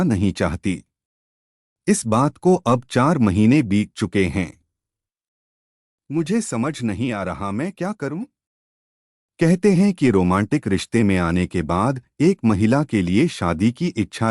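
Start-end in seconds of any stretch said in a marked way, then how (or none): none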